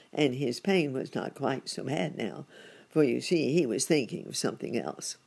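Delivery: ordinary delivery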